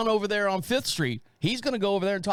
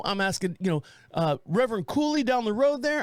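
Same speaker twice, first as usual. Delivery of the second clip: ordinary delivery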